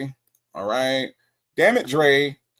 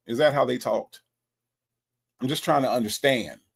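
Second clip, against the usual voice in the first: usual speaking voice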